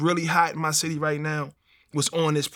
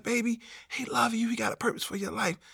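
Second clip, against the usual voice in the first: soft, raised voice imitating a nurse; tender nurse; 6 semitones higher, 6 dB quieter than usual. Nurse comforts a shot man about God's love, tender high voice. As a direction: comfort him gently, high and quiet.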